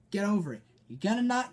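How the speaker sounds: normal talking voice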